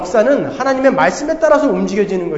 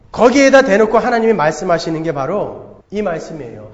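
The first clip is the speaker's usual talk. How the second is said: normal talking voice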